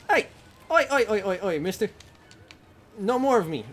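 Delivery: loud high calling out; shouting at monster. Real, not acted. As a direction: yell at the monster to back off.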